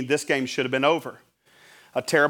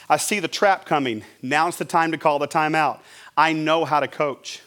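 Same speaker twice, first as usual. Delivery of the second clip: ordinary talk, nothing marked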